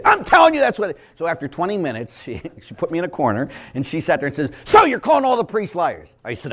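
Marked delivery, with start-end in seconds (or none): 0.0-1.0 high, louder insisting woman; mock shrill insistence. Woman insisting to the priest, mock high voice. 4.6-6.1 high, louder accusing tone; accusing woman. Retold or imitated. Woman confronting the priest, high accusing voice.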